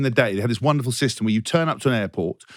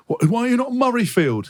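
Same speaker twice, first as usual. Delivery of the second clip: high, incredulous mimicked question; mock astonishment, pitched right up; baffled fan; 8 semitones higher than usual. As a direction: imitate someone who simply can't compute it. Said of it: "He was genuinely just couldn't compute".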